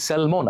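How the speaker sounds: স্যালমন with the l sounded, "sel-mon"; common mispronunciation. Shown as a demonstration. Teacher showing what we call the fish, mispronounced. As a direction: stress the l in স্যালমন. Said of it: "আমরা এল এ".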